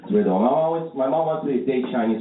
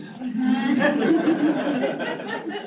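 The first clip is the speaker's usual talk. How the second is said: high mock laugh. Laugh after own punchline.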